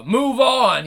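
quoting the singer's loud "Move on!"; loud call. Retold or imitated. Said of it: "He says it real loudly".

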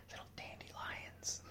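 breathy teasing whisper; mocking whisper. Real, not acted. Joking to viewers that women are fragile, in a mocking whisper. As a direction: murmur it like a private joke.

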